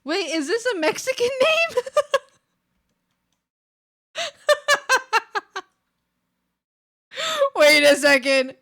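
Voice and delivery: high, loud startled laugh; shocked and amused; surprised. Real, not acted. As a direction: caught off guard, laughing.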